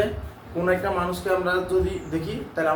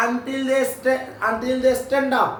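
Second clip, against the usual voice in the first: very slow, each word stretched for memorising; slow dictation; 6 semitones higher, much slower than usual. Shown as a demonstration. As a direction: dictate slowly so they can learn it by heart. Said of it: "এটা মুখস্থ রাখবি". (মুখস্থ করানোর জন্য খুব ধীরে বলা)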